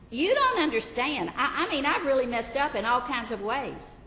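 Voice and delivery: a worried believer pleading with Jesus; anxious confessor. Acted.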